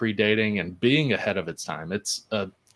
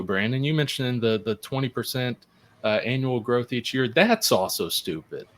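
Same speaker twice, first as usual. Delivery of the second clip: ordinary talk, nothing marked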